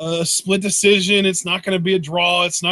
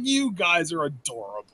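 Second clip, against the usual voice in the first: condescending; 3 semitones lower, 7 dB quieter than usual. Retold or imitated. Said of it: "And I'm just like".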